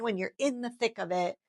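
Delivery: normal talking voice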